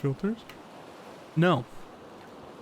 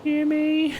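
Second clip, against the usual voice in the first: high nasal cartoon kid; Carl Wheezer voice; 11 semitones higher, 4 dB louder than usual. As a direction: call out like a whiny kid. Carl voice calling Jimmy.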